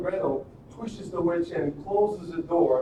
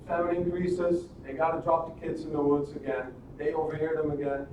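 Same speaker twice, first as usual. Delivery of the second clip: ordinary talk, nothing marked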